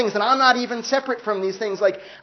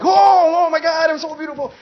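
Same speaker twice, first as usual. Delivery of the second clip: much higher and louder, squealing Oh; giddy desiring soul; 10 semitones higher, 7 dB louder than usual. Retold or imitated. Desiring soul admiring itself, giddy squeal. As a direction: gush vainly over yourself. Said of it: "the desiring soul makes you kind of like".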